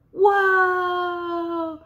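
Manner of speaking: long, high wow; big amazement. Pretend. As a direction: draw it out in show-and-tell wonder. Amazed at toy lighting up.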